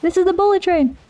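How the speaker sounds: thrilled by the speeding train; excited. Real, not acted. Gamer gushing at the bullet train, excited high voice. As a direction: pitch jumps high, racing words. Train racing past on screen.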